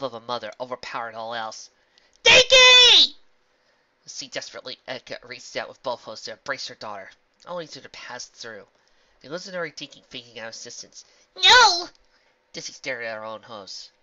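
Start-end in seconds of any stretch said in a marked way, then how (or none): 2.2-3.2 high, loud desperate call; desperate cry. Acted. Mother cries for daughter. 11.4-11.9 high, loud anguished cry. Acted. Mother sees illusion fade.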